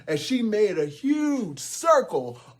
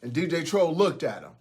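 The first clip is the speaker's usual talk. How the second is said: ordinary delivery, nothing marked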